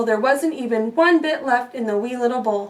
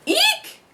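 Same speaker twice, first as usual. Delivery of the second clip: very high squeal; eek. Acted. Bears see Goldilocks, squealing eek. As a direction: squeal in fright. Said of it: "cried the three bears".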